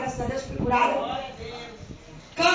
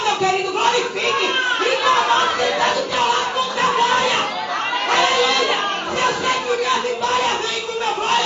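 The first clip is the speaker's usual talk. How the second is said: fiery preaching; 8 semitones higher than usual. Acted. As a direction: whip the church up into giving glory. Preacher rouses the congregation.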